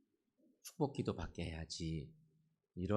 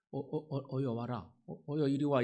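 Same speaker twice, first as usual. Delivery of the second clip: higher, eager come-here-come-here to the little ones; warm welcome; 7 semitones higher, 3 dB louder than usual. Retold or imitated. Jesus calling the children over to him, a light warm welcome. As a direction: beckon the children in, delighted. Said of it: "이러면서 맞이해 주시는 예수님".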